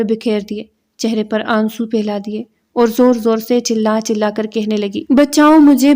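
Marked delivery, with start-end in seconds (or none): 5.1-5.9 high, loud fake scream for help. A narrator's reading. Cousin fakes an assault, screaming for help. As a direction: shriek it to fool the household. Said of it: "اس قدر زور سے چیختے ہوئے کہا تھا".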